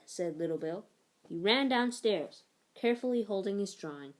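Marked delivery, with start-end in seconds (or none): none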